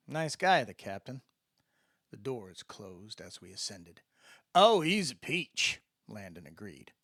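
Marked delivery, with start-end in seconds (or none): 4.5-5.8 a dry sarcastic Mississippi aside; insincere. A narrator's reading. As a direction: praise the captain without meaning a word of it. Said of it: "with as little sincerity as I had used".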